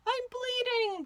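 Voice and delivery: high, sharp yelp of pain; woozy fox yelping as he comes to. A narrator's reading. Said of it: "the fox yelped".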